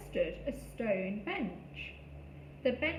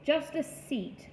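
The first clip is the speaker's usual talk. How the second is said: growly voice; 2 semitones higher, slower than usual. A narrator's reading. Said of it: "said the growly voice".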